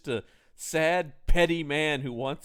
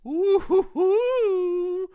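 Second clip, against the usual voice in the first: much higher, louder swooning hoo-hoos; mock swooning cry; 6 dB louder, much slower than usual. Actress gasping at the sliding bed, a high, loud swoon. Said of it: "Karen Gardner gives this, like".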